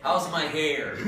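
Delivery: laughing as she jokes about her hair; a giggly self-mocking question, then a high laugh; amused, half-laughing. Real, not acted. Joking about own hair.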